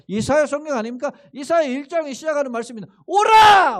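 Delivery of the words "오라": higher, much louder cry of 오라; shouted call. Retold or imitated. Preacher reading Isaiah's call to the congregation, shouted. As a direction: shout it out as God summoning.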